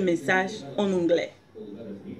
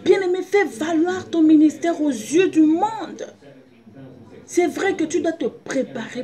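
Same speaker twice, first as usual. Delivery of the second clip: rising, louder preacher's call; preaching; 6 semitones higher, 5 dB louder than usual. Acted. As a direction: proclaim it to the congregation. Preaching rises in force.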